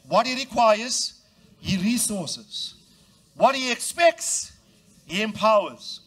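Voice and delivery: slow, loud rhythmic call; preaching cadence. Acted. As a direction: hammer each What, then resolve.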